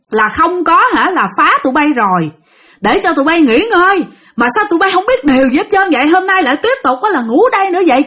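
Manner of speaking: high, fast, scolding ghost voice; angry ghost yelling. Retold or imitated. Ghost scolding the couple sleeping in his spot, high fast furious yelling. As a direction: rant like an old ghost chasing intruders off his spot. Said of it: "ổng vừa la".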